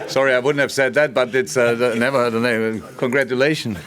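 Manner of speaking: laughing through the words, amused; real laughter at a surprising name; bursting into laughs over a strange name. Real, not acted.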